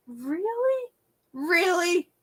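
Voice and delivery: high-pitched, disbelieving question; retold incredulous "really?". Retelling her upset disbelief at demolition.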